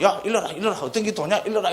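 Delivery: high-pitched, urgent acted demand; mock nagging challenge. Prodding a drunk, dozing husband for the answer, mock demand. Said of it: "남편이 술 먹고 가고".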